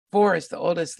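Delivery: usual speaking voice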